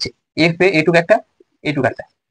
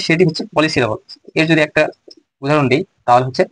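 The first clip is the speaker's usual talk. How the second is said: ordinary talk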